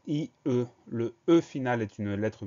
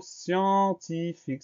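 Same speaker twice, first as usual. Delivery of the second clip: "scientifique" slow and higher, each syllable stretched; 7 semitones higher, much slower than usual. Shown as a demonstration. Teacher announcing the next spelling word, "scientifique" slowly syllabified. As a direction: dictate "scientifique" in long, separate syllables.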